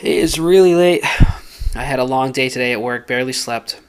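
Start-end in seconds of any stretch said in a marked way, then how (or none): 0.0-1.0 words stretched by a yawn. Real, not acted. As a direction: yawn through the line. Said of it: "sorry I'm yawning so much".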